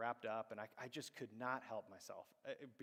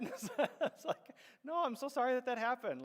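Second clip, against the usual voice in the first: laughing sympathy; 11 semitones higher, 9 dB louder than usual. Real, not acted. Laughing while consoling.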